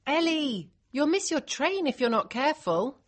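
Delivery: high warning call through a door. A narrator's reading. Liz knocks to get the girl up, calling out. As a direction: call out to hurry the sleeper. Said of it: "louder this time".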